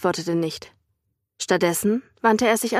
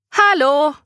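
a call across the room, higher; 7 semitones higher, 5 dB louder than usual. A narrator's reading. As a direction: call out to get his attention. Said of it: "rief seine Braut ihm zu".

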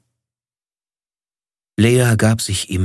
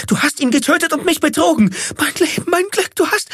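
high piercing scream of accusation; woman screeching at her betrayer; shrieking; faster than usual. A narrator's reading. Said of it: "kreischte sie".

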